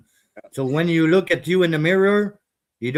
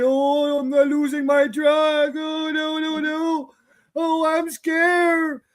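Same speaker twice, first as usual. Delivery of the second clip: much higher mock whimpering voice, squealing the complaints; mocking crybaby whine; 13 semitones higher, slower than usual. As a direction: mimic a whiner begging for money online in a high put-on wail. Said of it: "I was not crying online and say".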